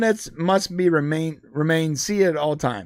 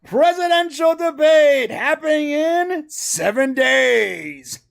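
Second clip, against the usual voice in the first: booming slow announcer hype; monster truck voice; 9 semitones higher, slower than usual. Acted. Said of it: "the monster truck voice".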